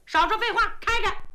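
high, loud princess snapping an order; impatient high command with a half laugh; sharp order. Acted. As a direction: cut him off and command him to open up. Princess orders guard to open.

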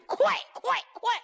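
high, urgent commander's cry; urgent urging. Acted. Commander urges his men on, high urgent cry. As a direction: drive the troops on. Jin Wuzhu urging troops on.

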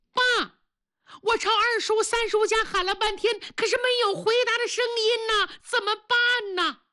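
put-on high, girlish voice, panicked and quick; a young daughter reporting to her father in a rush; frantic daughter; frightened daughter.

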